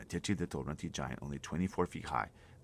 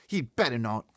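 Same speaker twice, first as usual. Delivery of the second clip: high and loud, outraged; indignant girl; 8 semitones higher, 12 dB louder than usual. Acted. Sophie outraged at the giant's plan, high loud. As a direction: spit it out in outrage.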